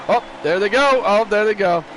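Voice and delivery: sudden excited play-by-play; excited call. Real, not acted. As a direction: call the wreck with a jolt. Wreck breaks out in race.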